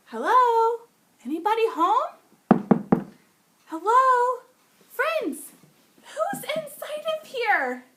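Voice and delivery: loud, high call: Hello? Anybody home?; calling down a hallway. Acted. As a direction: call out to someone asleep inside. We need to knock on the door.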